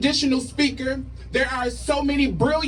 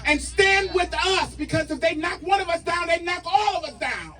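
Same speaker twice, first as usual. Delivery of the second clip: rally speech; 3 semitones higher than usual. Acted. Rally call to action, shouted.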